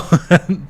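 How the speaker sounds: high burst of laughter; laughing. Real, not acted. Laughing at chat suggestion.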